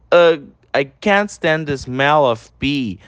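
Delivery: slow, deliberate English, each word apart; slow teacher demo of the line; slow pronunciation model. Shown as a demonstration. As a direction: model it slowly for students to repeat. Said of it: "repete a pronúncia em todos".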